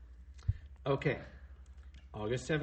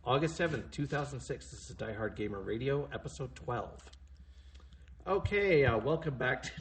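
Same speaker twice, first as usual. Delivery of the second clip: ordinary talk, nothing marked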